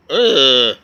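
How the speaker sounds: a loud, fed-up ugh; disgusted groan. Retold or imitated. Retold man groaning loudly at being called a dum-dum. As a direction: groan it out like you're sick of the whole thing.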